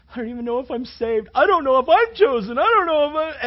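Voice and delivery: loud, high panicked pleading; loud, high imitated anguish; distressed student. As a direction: cry it out like a frightened kid. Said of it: "he was crying and he was going".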